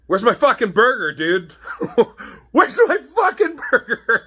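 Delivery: loud, high, furious shout breaking into laughs; cracking, laughing rant at an empty bag; mock outraged yell. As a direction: yell it like the burger is stolen. Customer yelling at missing burger.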